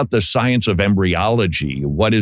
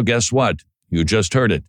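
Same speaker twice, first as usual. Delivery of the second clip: ordinary delivery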